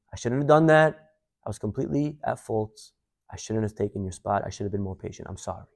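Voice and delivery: soft, sinking apology; contrite apology. Retold or imitated. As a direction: own up quietly, voice dropping away. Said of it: "I'm calling to apologize".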